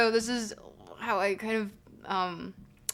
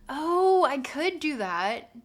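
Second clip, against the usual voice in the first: a hint of a SoCal surfer drawl, slow; 5 semitones higher, 4 dB louder, much slower than usual. Streamer tries a SoCal surfer voice for chat. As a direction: stretch it out like a laid-back California surfer. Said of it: "like a surfer".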